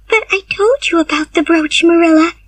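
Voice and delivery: high, pleading protest; crying out. Acted. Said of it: "cried Anne".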